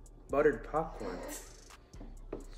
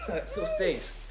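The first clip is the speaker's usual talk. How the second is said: laughing; slower than usual. Real, not acted. Laughing at the smell.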